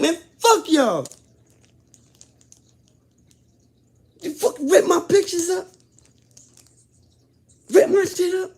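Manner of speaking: high, loud furious yelling at the guards; raging. Retold or imitated. Prisoner cursing the guards who ripped his pictures, raging. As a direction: let the rage explode, cursing them out. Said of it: "I just was raged".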